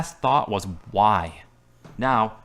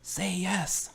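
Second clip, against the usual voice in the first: whispering; 9 semitones higher, 7 dB quieter than usual. Acted. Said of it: "whispers to Carolyn".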